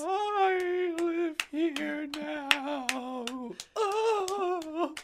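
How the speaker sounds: high, slow ghostly moan. Pretend. Friend joins ghost.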